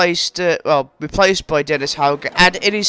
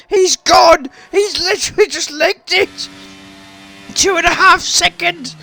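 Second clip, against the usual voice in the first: high-pitched, excited race-commentary yelling; real excited hollering, pushed till the voice gives; voice-straining excitement; 9 semitones higher than usual. Real, not acted. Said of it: "my voice is going already".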